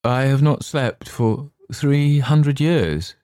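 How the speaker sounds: slow, sorrowful lament; weary and sorrowful, slowly; sad. Acted. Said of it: "he said sadly".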